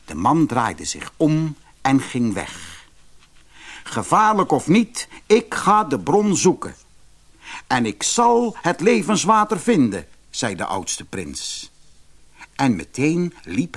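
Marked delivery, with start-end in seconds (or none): 3.8-10.1 a bold prince, pitched up; determined eldest prince. Acted. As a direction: declare it with resolve.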